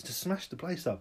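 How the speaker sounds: ordinary delivery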